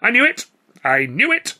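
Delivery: high, loud exclamation; triumphant cry. A narrator's reading. Old clown has guessed the comedian's trade, triumphantly crying out. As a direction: cry out at being proved right. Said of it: "Walker cried".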